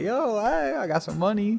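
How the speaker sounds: high-pitched hailing voice; retold calling out. Teen hails people with beer, retold calling out.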